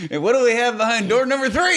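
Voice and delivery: high, loud, quick game show host; hammed-up game show host voice.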